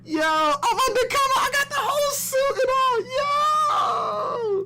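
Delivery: high, slow, laughing yell; laughing shout. Real, not acted. Fan gloating about his undercover cameo, laughing and yelling high. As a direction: crow with delight.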